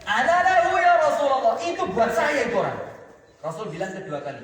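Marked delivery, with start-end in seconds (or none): 0.1-2.8 loud, high eager cry; insistent volunteering. Acted. Ali volunteering once more, loud and insistent. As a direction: cry out to claim the fight.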